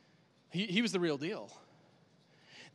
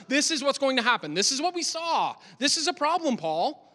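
higher, insistent show and tell; the crowd warning Paul of his fate, higher and insistent; urgent warning; 7 semitones higher, 6 dB louder than usual. Acted. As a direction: push the point home, pitch up.

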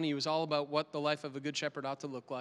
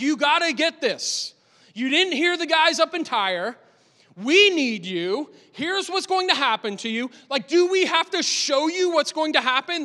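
higher and louder, pressing and eager; the worried crowd begging Paul to listen, pitched up and loud; urgent crowd plea; 9 semitones higher, 11 dB louder than usual. Acted. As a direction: urge him hard, voice up high.

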